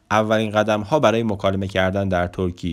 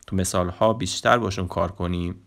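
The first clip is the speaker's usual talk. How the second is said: usual speaking voice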